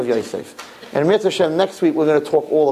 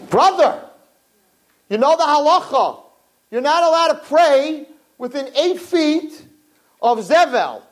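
higher, insistent brother's voice; urgent brother; 12 semitones higher, slower than usual. Retold or imitated. Brother explaining the halacha, higher and urgent. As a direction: press the halacha on him. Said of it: "He said".

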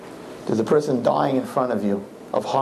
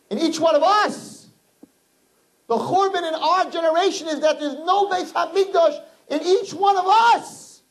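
impassioned preaching. Acted. Rabbi urging the crowd that the churban is within, high and fervent. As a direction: stretch each one of us with fervor.

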